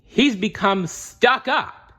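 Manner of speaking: he's become stuck up, high and slow after a pause; dramatic pause. A narrator's reading. Butler Alfred reveals the villain's flaw with drama. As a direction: land the punchline slowly and high. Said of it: "pausing dramatically".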